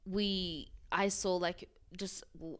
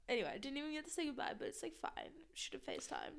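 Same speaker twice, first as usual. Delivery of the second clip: quiet; 6 semitones higher, 12 dB quieter, faster than usual. Real, not acted. Trailing off about pet's death.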